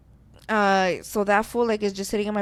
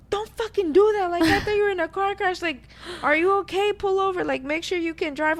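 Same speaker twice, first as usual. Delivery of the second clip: put-on worried boyfriend; 8 semitones higher than usual. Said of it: "he's all like".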